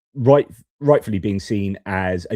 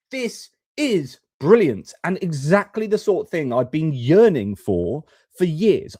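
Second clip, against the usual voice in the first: higher, bursting with delight; light up at the good news; pitched-up enthusiastic rave; excited praise; 6 semitones higher than usual. Real, not acted.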